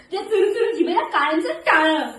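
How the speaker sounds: ordinary delivery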